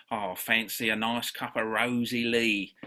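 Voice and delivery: slow, hammed-up Cockney offer of tea; Cockney accent. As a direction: put on a mock London Cockney voice. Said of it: "Apologies to any residents of London there for the accent".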